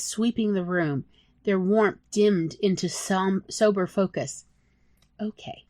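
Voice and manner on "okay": soft, breath-quiet okay; hushed okay; whispered. Acted. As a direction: barely let it out. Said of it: "I whispered".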